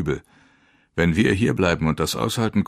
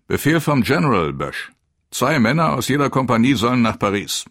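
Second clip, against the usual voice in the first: raised, higher, emphatic order; commanding officer; 6 semitones higher, 6 dB louder than usual. A narrator's reading. Captain on radio relays the general's order to Bösch, loud and commanding. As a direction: pass the order on loudly and firmly.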